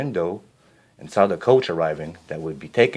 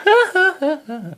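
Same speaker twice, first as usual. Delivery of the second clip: high mocking stepsister shriek of laughter; 5 dB louder than usual. A narrator's reading. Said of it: "shrieked with laughter".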